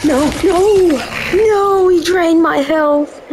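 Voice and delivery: exasperated protest, stretching it out; outraged yell. Real, not acted. Gamer yelling at a teammate who drained his health, outraged.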